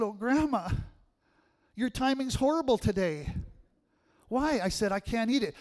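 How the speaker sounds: lightly voiced pleading grandson; stuffed grandson.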